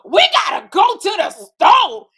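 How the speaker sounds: shouted, high, slow mock of Kim's loud line; loud sassy repeat. Retelling how Kim repeated Tisha's line, hammed-up high shout. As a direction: belt it out, hammed-up ratchet sidekick. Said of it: "So Kim would go".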